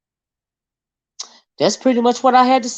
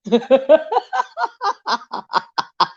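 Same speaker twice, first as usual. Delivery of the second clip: long real cackling laugh; high burst trailing down; 4 semitones higher than usual. Real, not acted. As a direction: crack up after teasing. Laughing after teasing rival fans.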